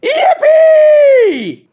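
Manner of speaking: very high, loud squeal; jubilant cheer. Acted. All the other badgers rush out free, jubilant squeal. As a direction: cheer at the top of the voice. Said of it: "jubelten all die anderen Dachse".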